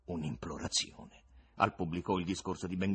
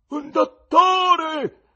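high, loud, drawn-out cry for help; terrible scream; 15 dB louder, much slower than usual. A narrator's reading. Severed head begs for help, terrible scream. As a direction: scream slowly through the whole theatre. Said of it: "gridò tremendamente per tutto il teatro".

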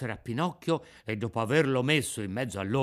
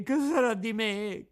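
rising wail; 9 semitones higher than usual. A narrator's reading. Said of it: "continuava a piangere, a berciare".

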